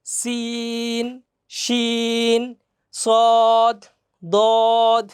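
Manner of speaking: each letter held long and slow; টেনে টেনে উচ্চারণ; drawn-out letters. Shown as a demonstration. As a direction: chant the letters slowly. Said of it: "টেনে টেনে".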